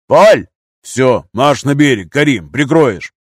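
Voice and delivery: loud, slow, forceful commands; shouted orders. A narrator's reading. Gunner ordering comrade ashore to cover, loud shout. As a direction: command them over the noise.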